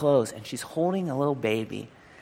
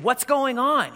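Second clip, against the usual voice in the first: higher, louder startled call; alarmed call; 12 semitones higher, 5 dB louder, slower than usual. Retold or imitated. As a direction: burst in asking what's wrong. Martin runs over to the ruckus.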